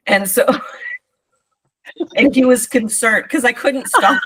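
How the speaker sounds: can barely get it out for laughing; laughing while talking. Real, not acted. Telling a friend about last night, laughing through it.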